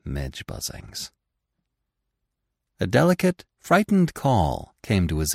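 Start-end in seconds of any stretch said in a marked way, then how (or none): 0.0-1.1 musing to himself. Acted. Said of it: "he thought".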